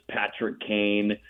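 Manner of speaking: normal talking voice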